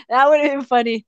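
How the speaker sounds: normal talking voice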